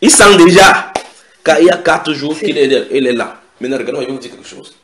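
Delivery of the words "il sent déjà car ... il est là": loud, high-pitched objection; protesting Martha. Retold or imitated. Preacher as Martha objecting at the tomb, loud protest. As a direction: cry out in protest, easing toward the end. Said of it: "lui dit".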